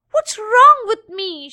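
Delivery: distressed. A narrator's reading. Ashamed daffodil cries out inwardly.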